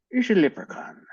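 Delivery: squeaky high leprechaun. Pretend. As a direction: pitch way up like a leprechaun. High excited leprechaun announcement.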